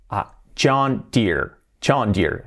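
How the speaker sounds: John Deere as "chond ir", first slowly; English pronunciation. Shown as a demonstration. Teacher repeating John Deere for learners. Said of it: "se pronuncia John Deere".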